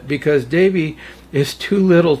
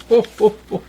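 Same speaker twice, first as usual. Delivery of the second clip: Santa laugh; 6 semitones higher, much faster than usual. A narrator's reading.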